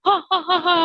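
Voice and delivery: high, loud imitated ho-ho-ho; belly-laugh imitation of Santa; Santa's laugh. Imitates Santa's laugh among the children.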